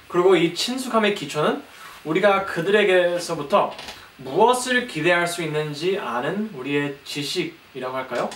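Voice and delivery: ordinary talk, nothing marked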